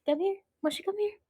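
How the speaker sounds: very high calling voice; coaxing pet call. Real, not acted. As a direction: sweetly call the dog. (malambing na pagtawag sa aso)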